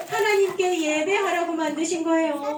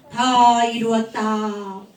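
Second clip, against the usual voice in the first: solemn last words; 5 semitones lower, 4 dB louder, much slower than usual. Acted. Jesus on the cross.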